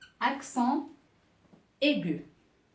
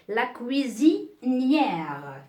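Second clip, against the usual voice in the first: "cuisinière" stretched out slowly, stressing the final ière; slow dictation; much slower than usual. Shown as a demonstration. Teacher slowly stresses the feminine "cuisinière" for the pupils. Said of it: "teacher stresses feminine form".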